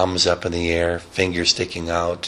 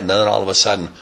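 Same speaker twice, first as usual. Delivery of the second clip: ordinary delivery, nothing marked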